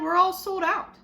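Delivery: a hint of a cheery shop clerk; shoe salesman voice. Shoe man voiced in story.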